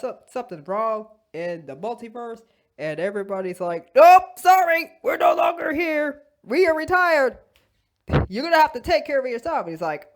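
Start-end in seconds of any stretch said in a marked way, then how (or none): 3.9-9.7 loud, high, brusque brush-off; dismissive refusal. Acted. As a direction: turn him down emphatically. Everybody refuses Doctor Strange.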